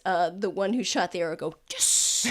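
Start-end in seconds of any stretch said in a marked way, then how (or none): 1.7-2.2 whispered, unvoiced mock cheer; distant triumphant shooter. Archer in the distance after his shot, whispered triumph. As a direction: cheer in a whisper, as if far away. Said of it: "you hear in the distance".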